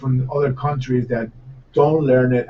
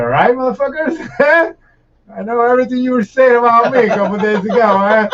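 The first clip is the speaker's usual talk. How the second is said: gloating call-out; 10 semitones higher, 5 dB louder than usual. Retold or imitated. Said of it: "I was like".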